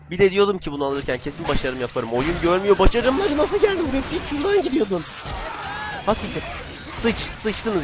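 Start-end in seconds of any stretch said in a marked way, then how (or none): 2.8-7.8 very high, frustrated complaint; exasperated outburst. Real, not acted. Gamer angry at missed achievements, high exasperated outburst. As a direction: let the complaint climb into a high wail.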